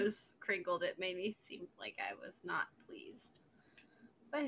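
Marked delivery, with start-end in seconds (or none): 0.8-3.4 whispering. Real, not acted. Gossip whisper.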